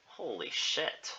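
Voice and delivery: slow, soft swearing; stunned. Real, not acted. Reader taking in seven feet, stunned. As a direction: let it out slowly and quietly.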